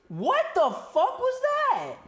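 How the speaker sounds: high, slow, stretched disbelief; shocked outburst. Real, not acted. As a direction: shriek it out in total disbelief. Fan reacts to disputed catch.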